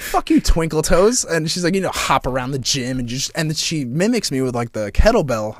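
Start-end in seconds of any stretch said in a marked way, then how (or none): 0.0-1.2 teasing wife. Retold or imitated.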